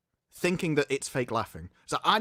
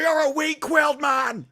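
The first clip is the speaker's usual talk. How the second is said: loud, high, slow mock dominatrix command; laughing through a mock command; 10 semitones higher, 5 dB louder, slower than usual. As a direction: ham up a domineering boss. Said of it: "goes completely, like, dominatrix".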